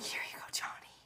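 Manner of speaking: soft, secretive pretend whisper; sneaky whisper. Fan pretending to sneak Johnny a pug, sneaky whisper. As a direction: whisper it while slipping over contraband.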